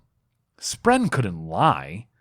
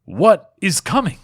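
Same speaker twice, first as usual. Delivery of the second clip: higher, louder demand; urgent demand; 8 semitones higher, 5 dB louder than usual. A narrator's reading. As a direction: press hard for an answer, higher and louder. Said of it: "Gavilar demanded".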